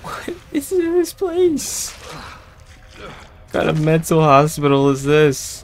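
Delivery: scared high voice. Real, not acted. Scared exploring hospital.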